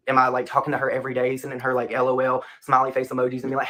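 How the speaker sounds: normal talking voice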